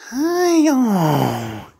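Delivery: high, cooing pet voice. Real, not acted. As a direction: gush over a puppy, high. Cooing at the dog.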